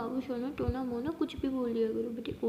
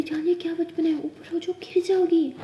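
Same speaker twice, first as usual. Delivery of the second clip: loud, higher anxious plea; worried call; 5 semitones higher, 6 dB louder than usual. Acted. Aliya frightened Maya will fall, calling out loudly. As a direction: call out in alarm to pull her back.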